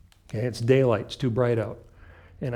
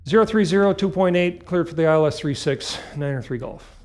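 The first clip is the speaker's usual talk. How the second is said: quick clipped readback, higher; pilot radio readback; 4 semitones higher, 4 dB louder than usual. Shown as a demonstration. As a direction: fire back the readback briskly. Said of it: "That's my readback".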